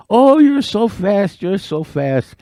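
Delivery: high, slow, drawn-out gushing; mock-awed turtle. Turtle trailing the beaver, falsely admiring him. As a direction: coo the flattery high, then let it sink.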